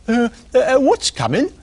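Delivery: high, eager squirrel voice; pry like a nosy squirrel; nosy squirrel asking the pig. Acted. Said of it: "who was also very nosy".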